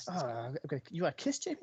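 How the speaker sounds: mumbling to himself. Retold or imitated. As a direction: mumble the lines quietly to yourself. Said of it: "Some of the mumbling he does".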